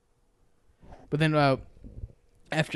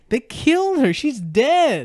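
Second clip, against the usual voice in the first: much higher, louder burst of disbelief; shocked shout; 11 semitones higher, 10 dB louder, slower than usual. Real, not acted. Friend blurts out to friend that the chef died, shouting. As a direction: yell it out, stunned at the ending.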